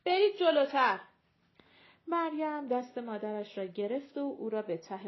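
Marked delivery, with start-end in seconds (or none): 0.0-1.0 loud, sharp order to move forward; shout. A narrator's reading. Bus conductor ordering passengers forward, loud shout. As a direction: bark it across a crowded bus. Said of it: "شوفر داد زد".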